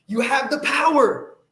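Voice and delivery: rallying shout to the viewers; loud punch. Real, not acted. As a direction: hit it hard like a rallying cry. Repeated line, quiet then loud.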